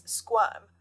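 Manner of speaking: ordinary talk, nothing marked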